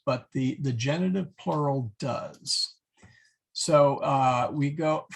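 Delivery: ordinary delivery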